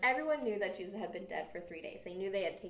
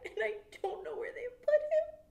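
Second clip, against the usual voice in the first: high, loud tearful woman's voice; Mary crying; 6 dB louder than usual. A narrator's reading. Mary cries to the angels, high and loud. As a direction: plead through tears, voice pitched up. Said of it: "Mary was standing outside of the tomb crying".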